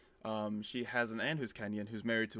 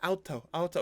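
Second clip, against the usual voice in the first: put-on baby voice; 7 semitones higher than usual. Baby saying car in German.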